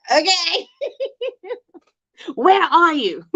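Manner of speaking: high laughing okay. Real, not acted. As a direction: laugh through the question. Laughs retelling lost meetup.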